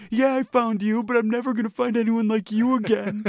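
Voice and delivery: high, fast, sobbing-style ghost plea; hammed-up ghost breakup plea; heartbroken ghost. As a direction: beg your lover not to leave. Ghost pleads in mock breakup.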